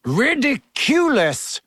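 high, stretched-out narrated shout; Seamus. A narrator's reading. Pupil facing a shrieking banshee, high drawn-out shout. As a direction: shout the spell slowly, pitch lifted, volume contained. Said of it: "shouted Seamus".

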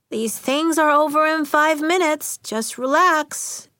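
lightly voiced adult telling a child to relax; dismissive reassurance. A narrator's reading. Substitute leaving a worried girl behind, dismissively reassuring.

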